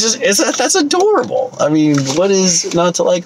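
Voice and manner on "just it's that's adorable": gushing. Real, not acted. Gushing over kids.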